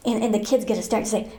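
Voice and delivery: usual speaking voice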